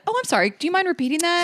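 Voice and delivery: high, sugary fake apology; playing dumb. Shown as a demonstration. Picturing the energy for her cohost, high sugary play dumb. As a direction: feign innocence, sweet and bright. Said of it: "plain dumb".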